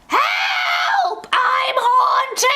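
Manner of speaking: high, shrieking cry for help; haunted cry for help. Shown as a demonstration. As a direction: scream for help like you're haunted. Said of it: "first one we have now is, "Help, I'm haunted".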